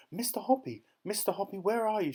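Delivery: calling out high and eager from below; excited shouting. A narrator's reading. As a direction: shout up to him, thrilled. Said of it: "she was shouting".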